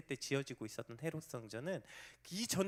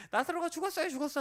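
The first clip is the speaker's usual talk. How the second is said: panicked people; 13 semitones higher, 5 dB louder, faster than usual. Retold or imitated. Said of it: "사람들이 막".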